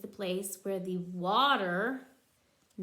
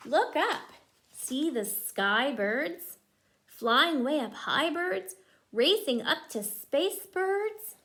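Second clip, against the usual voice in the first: lively rhyming picture-book read; reading to children; 3 semitones higher, 5 dB louder, slower than usual. Acted. Mother reading a bird book to her child, lively rhyme. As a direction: bounce the rhyme for a small child.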